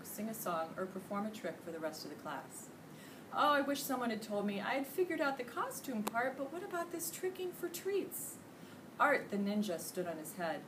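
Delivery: usual speaking voice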